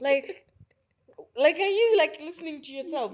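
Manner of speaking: high, loud disbelief; are you serious, voice jumping up; loud incredulous squeak; incredulous retort. Retold or imitated. Retold disbelief at attacker.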